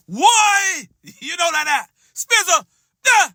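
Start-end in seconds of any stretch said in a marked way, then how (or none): 0.1-0.9 high yelled why, half-playful; a hammed-up scream of pain; mock scream. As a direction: cry out like you're being beaten. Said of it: "That's when you scream out".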